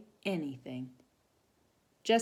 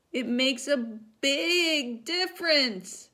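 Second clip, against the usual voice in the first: near tears; 10 semitones higher, 7 dB louder, much slower than usual. Acted. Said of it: "she burst into tears".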